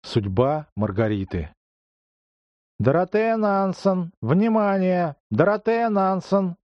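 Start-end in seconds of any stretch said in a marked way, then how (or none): 2.8-6.5 booming high announcement over a PA; loudspeaker voice. Acted. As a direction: call it out like a police megaphone. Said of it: "гремел ... голос из громкоговорителя".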